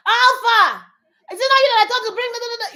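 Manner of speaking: high, loud, rapid-fire scolding; family shout. Retold or imitated. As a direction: yell a name, then rattle off the complaint. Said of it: "We'll shout".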